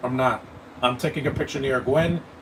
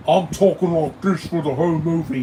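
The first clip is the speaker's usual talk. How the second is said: loud, higher British rocker caricature; mock British accent; 4 semitones higher, 6 dB louder than usual. Reviewer ridiculing Spider-Punk's way of talking, exaggerated British accent. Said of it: "He talks like a character from This Is Spinal Tap".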